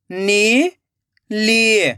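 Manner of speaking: नीली split into नी, long pause, ली; rising नी, then falling ली; syllable by syllable. Shown as a demonstration. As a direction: sound out नीली very slowly in two parts. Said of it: "एक एक अक्षर का उच्चारण करना है".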